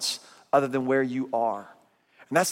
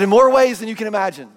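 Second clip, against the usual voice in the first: loud punchline; 5 semitones higher, 7 dB louder than usual. Retold or imitated. Punchline reply to Rick.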